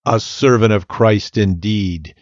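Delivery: plain reading voice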